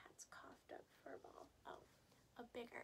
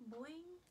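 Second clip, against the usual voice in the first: higher, bouncy boing sound; springy boing; 6 semitones higher, 4 dB louder than usual. A narrator's reading. Hair springing back after Mom pushes it, bouncy boing.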